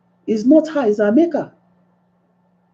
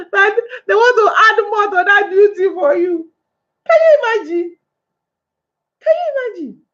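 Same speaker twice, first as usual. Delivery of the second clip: high-pitched laughing outcry; incredulous laughing protest; laughing indignation; 11 semitones higher than usual. Real, not acted. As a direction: cry out in laughing disbelief, much higher. Laughing indignation about husbands.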